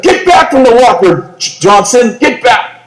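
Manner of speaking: retold sergeant barking an order; sergeant's bark.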